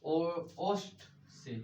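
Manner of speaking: ओष्ठ drawn out slowly for the class; slow, clear teacher answer; slow spelled-out answer. Shown as a demonstration. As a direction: say ओष्ठ से slowly so students catch it. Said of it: "कहाँ sir?".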